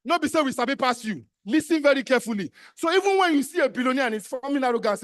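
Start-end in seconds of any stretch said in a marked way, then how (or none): none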